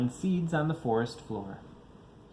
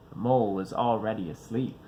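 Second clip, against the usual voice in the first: plain reading voice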